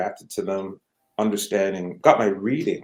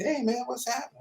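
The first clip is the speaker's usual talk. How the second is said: higher-pitched retold hey-man greeting; casual excited greeting; 7 dB quieter, faster than usual. Camille spots him in the music store, casual high greeting. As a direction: greet an old acquaintance, pitched up.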